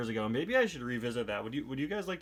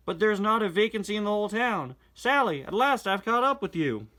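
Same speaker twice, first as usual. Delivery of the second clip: louder, higher character reading; animated reading of the twins and Sally; comic dialogue read-aloud; 8 semitones higher, 6 dB louder than usual. Acted. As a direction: perform the comic lines with energy.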